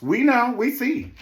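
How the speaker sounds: loud, high comeback; impatient retort. Real, not acted. Host answering Robin's excuse, loud impatient retort.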